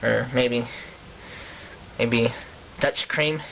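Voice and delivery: low, hesitant musing; Dutch interviewee. Acted. Colonist guesses at inventions for interviewer, low and hesitant. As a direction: think aloud in a low character voice.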